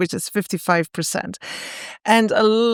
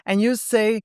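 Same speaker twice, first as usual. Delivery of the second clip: normal talking voice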